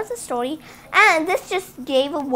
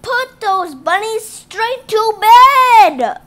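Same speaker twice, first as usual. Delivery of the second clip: slow, much louder and higher furious order; angry doctor; 8 semitones higher, 10 dB louder, slower than usual. Acted. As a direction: shout it slowly like a fed-up doctor. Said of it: "Wow, that guy's angry".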